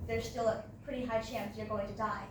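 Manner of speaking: usual speaking voice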